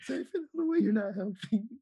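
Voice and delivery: high, loud mock yell at Zay; mock shout. Retelling his fake phone scene.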